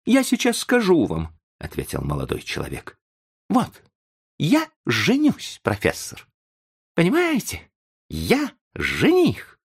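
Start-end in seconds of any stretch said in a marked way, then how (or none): 0.0-1.3 put-on high-pitched agitated young man. A narrator's reading. Young man about to explain himself, agitated. 3.5-9.6 very high, overwrought announcement; agitated declaration. A narrator's reading. Young man tells the professor he is marrying, agitated. Agitated confession of his engagement.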